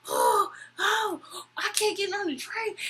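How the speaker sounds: high-pitched fake crying complaint; mock drunken wailing. Drunk man wailing over refusal.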